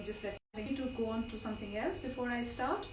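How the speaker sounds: ordinary talk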